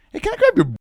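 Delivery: high, put-on sheepish voice; cheeky pretend request; mock sheepish ask. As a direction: ask it in a silly squeaky voice. Acted quote, joking request.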